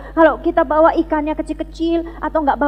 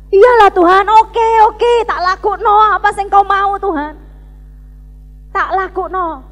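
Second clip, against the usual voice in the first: loud, high-pitched mock tantrum at God; tearful, angry compliance; 6 semitones higher, 8 dB louder than usual. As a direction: give in grudgingly, acting out a tearful outburst. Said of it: "sambil nangis dan ngamuk-ngamuk". (pura-pura pasrah sambil ngamuk dengan suara keras)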